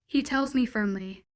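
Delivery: plain reading voice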